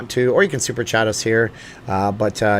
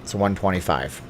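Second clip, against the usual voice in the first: ordinary talk, nothing marked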